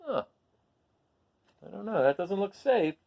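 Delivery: ordinary delivery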